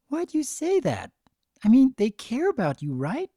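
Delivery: high, puzzled little filly voice; confused filly. Acted. Filly asking another foal why, high and puzzled. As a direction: ask it honestly like a small child.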